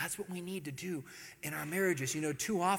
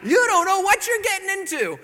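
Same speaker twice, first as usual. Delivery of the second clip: laughing; 14 semitones higher, 13 dB louder than usual. Retold or imitated. Teasing the newly married friend.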